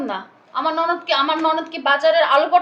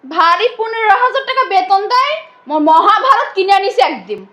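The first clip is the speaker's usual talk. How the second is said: loud, drawn-out sarcastic jeer; scornful mockery; 3 semitones higher, 6 dB louder than usual. Sister-in-law belittling her salary, scornful mockery. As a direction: sneer at it with slow, loud emphasis.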